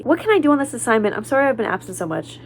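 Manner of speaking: quick, high mocking read of the text; mocking quote. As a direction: mimic the groupmate's pleading message. Reads out absent groupmate's text.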